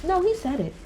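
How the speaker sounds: high, sing-song mimicry of a busybody; mock her certainty in a high voice; mocking know-it-all insistence. Mocking Jen talking as if she was there, high insistence. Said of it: "as if you was actually there".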